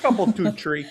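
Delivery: mock Minnesota accent. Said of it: "And we have great accents".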